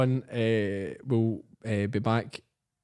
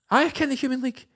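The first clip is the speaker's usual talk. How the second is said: high-pitched mock indignant woman; put-on high, grudging answer; thrown-off indignant reply; 13 semitones higher than usual. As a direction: imitate an irate drunk woman caught off guard. Drunk woman thrown off mid-rant.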